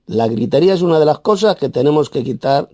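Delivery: ordinary delivery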